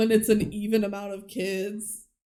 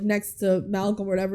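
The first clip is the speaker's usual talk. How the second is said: ordinary talk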